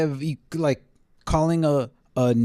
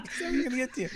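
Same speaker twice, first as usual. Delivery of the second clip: mocking his own "never gonna get to you", pitched much higher and softer; mock self-quote; 10 semitones higher, 5 dB quieter, much faster than usual. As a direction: parrot your own false promise back with a laughing sneer. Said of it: "there was an evacuation alert".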